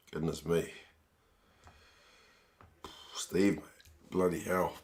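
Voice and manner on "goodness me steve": ordinary talk, nothing marked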